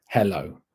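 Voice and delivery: Hello as HEH-low, with a clear "eh"; Hello with both syllables given their full vowels; full first vowel. Shown as a demonstration. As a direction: pronounce the written e in Hello fully. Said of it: "you're pronouncing the full shape of it".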